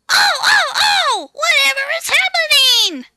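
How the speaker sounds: high, loud panicked squeals; screaming trucks. A narrator's reading. Silly trucks bumping into each other screaming in high panic. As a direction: shriek like startled trucks.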